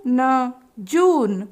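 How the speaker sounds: very slow syllable drill; teacher building words slowly; slow sounding out. Shown as a demonstration. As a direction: stretch each part for children. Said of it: "उच्चारित करने के लिए कहेंगे".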